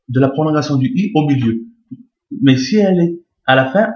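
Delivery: usual speaking voice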